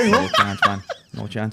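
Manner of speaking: real laugh through a flat no; laughing refusal. Real, not acted. Refusing to try raisins, laughing. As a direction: refuse while cracking up. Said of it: "I'm not trying it".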